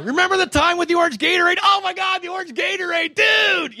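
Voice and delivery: mock a bro gushing over an inside joke; giddy inside joke. Two podcast guys laughing over a private joke, shouting excitedly. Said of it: "they're laughing about orange Gatorade".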